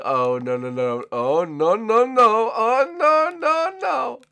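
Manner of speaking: oh no building from steady to very high; panicked wail that keeps rising. Real, not acted.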